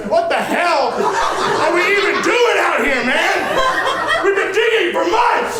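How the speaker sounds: high, loud exasperated yell; disgusted outburst. Acted. Young digger fed up at the dig site, exasperated yell. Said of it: "throws his shovel down in disgust".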